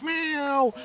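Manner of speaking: high imitation of a cat's meow. Cats wanting something from their human, imitated high meow. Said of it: "you hear, like".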